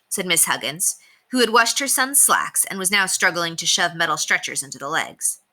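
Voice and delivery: ordinary delivery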